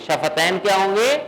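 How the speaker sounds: ordinary talk, nothing marked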